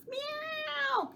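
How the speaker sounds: long high meow; cat meow. Pretend. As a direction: meow like a kitten. Cat sound in rhyme.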